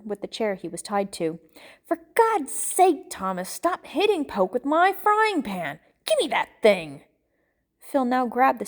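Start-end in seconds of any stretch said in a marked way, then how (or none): 1.9-7.0 high, loud, exasperated scolding; fed-up yell that starts high and drops; exasperated outburst. Acted. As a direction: cry out in frustration, then order it handed over. Exasperated man scolds friend.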